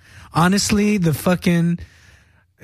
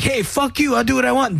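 put-on imagined defiant kid; 7 semitones higher than usual. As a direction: imitate a kid talking back. Imagined defiant kid talking back.

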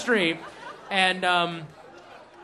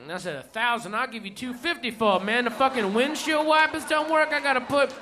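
higher, rowdy storytelling rant; ranting stand-up bit; 3 semitones higher than usual. Retold or imitated. Comedian performs the friend's set, rowdy and higher. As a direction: tear into it like an angry comic.

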